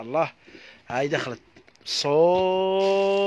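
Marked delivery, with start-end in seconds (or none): 1.8-3.3 one word stretched long and loud; drawn-out call. Real, not acted. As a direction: hold the word out like a market call.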